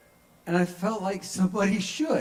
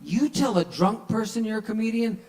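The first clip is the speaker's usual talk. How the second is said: normal talking voice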